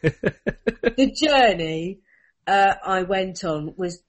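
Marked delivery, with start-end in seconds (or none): 0.0-2.0 laughing drawl. Real, not acted.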